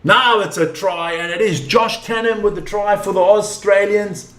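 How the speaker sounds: usual speaking voice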